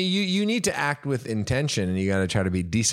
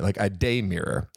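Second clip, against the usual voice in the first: usual speaking voice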